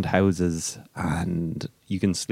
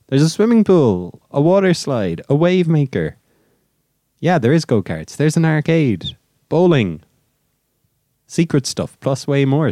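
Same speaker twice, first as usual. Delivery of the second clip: excited; 6 semitones higher, 3 dB louder than usual. Pretend. Reading hype list off website.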